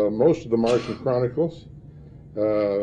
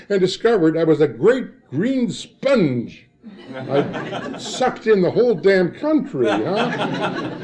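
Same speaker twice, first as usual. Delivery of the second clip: laughing through the words, slowed and higher; 6 semitones higher, slower than usual. Real, not acted. Telling librarians about soaking up Ireland, laughing. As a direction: chuckle it out with delight.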